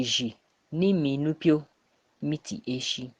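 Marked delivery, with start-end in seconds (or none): none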